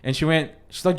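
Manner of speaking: usual speaking voice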